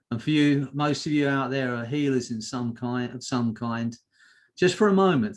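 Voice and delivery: low, measured voice settling at each phrase; guided hypnosis. Real, not acted. Hypnotist relaxing listeners' eyes and body, low calming trance voice.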